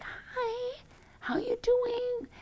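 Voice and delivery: high, breathy hospital-visit whisper; funeral whisper. Retold or imitated. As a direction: creep in like it's a funeral. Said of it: "creep in and be like".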